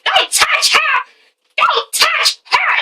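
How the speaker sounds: very high-pitched mock protest; mock outburst. Acted outburst of Brandon in quarrel.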